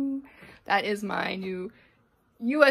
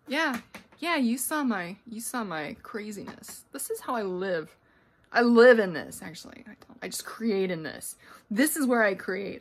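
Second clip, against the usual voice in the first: normal talking voice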